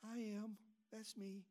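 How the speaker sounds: soft, timid little I am; wimpy shy. Shown as a demonstration. Pastor demoing a wimpy, shy I am. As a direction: shrink back, barely voicing it. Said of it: "It's not a wimpy, shy".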